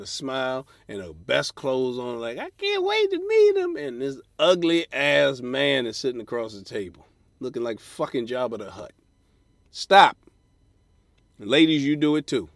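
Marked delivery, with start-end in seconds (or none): 2.4-3.8 high, breathless mock-eager woman; mock woman's voice. Woman imagining meeting date. 9.7-10.2 loud, sharp stop; a loud exasperated stop; shouted stop. Real, not acted. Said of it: "comic yelling at daters".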